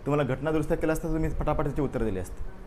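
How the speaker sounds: normal talking voice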